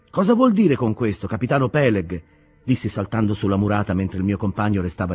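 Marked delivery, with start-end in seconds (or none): 0.1-2.2 raised, challenging question; indignant young sailor. Acted. Ishmael challenging captain Peleg, indignant. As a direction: demand an explanation.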